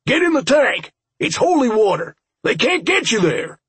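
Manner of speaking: very high, urgent yell. A narrator's reading. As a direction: yell urgent orders at someone running past. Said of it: "Sam yelled as one of the firefighters".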